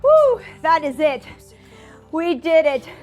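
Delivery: normal talking voice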